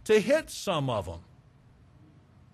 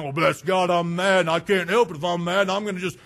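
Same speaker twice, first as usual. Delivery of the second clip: louder, faster hammed-up tantrum; mock angry rant; 4 dB louder, faster than usual. Preacher mocking a cursing hothead, fast and loud. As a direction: play a hothead excusing himself.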